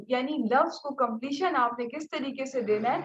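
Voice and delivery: ordinary talk, nothing marked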